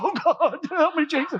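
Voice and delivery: high, loud cry for help; hammed-up desperate plea to heaven; mock panic. Husband reacts to wife flushing her medicine, mock panic. Acted panic when his faith shook.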